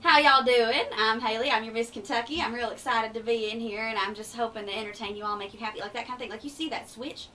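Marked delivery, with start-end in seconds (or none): 0.0-5.8 loud, folksy Eastern Kentucky drawl; full down-home Kentucky twang, greeting the room. Shown as a demonstration. Miss Kentucky greeting a nursing home crowd, broad Kentucky drawl. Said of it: "if you catch me in a nursing home".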